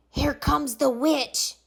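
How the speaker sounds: usual speaking voice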